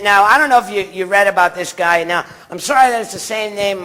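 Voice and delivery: ordinary delivery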